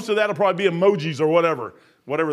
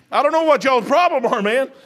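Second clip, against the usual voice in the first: emphatic call-out; 6 semitones higher, 7 dB louder than usual. Real, not acted. Preacher raising voice at congregation.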